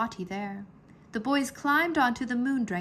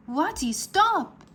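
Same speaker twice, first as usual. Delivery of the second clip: higher, louder, slowed command; yell; 8 semitones higher, 5 dB louder, slower than usual. A narrator's reading. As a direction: yell at the dragon to stop. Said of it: "Heru yelled".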